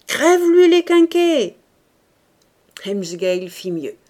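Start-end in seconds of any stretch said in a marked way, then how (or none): 0.1-1.6 loud, high ringside yell; cheering exhortation. A narrator's reading. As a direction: egg the fighter on at full voice. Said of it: "lui répétaient avec bienveillance l'exhortation". (exhortation criée avec entrain)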